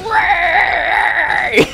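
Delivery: very high, loud parody shriek; mock raging scream. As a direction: scream it like a hammed-up tantrum. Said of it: "raging and raging and raging".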